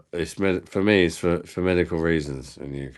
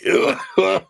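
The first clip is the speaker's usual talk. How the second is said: high giggle; 14 semitones higher, 4 dB louder than usual. Real, not acted. Cracking up at the stream.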